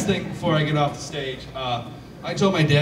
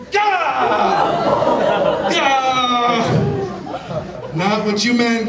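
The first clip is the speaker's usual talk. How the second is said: loud, laughing cry of alarm; mock horror, laughing loud; panicked yell; 7 semitones higher, 8 dB louder, much slower than usual. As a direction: shout at yourself for the thought, higher and louder. Panics at own dumb thought.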